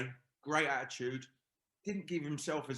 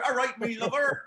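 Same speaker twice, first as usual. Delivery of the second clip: high, loud West Country "All right, me lover"; West Country greeting; 11 semitones higher, 9 dB louder than usual. Retold or imitated. Teammates mimic a West Country player's greeting, loud and high. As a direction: camp up the West Country charm. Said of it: "No, we go".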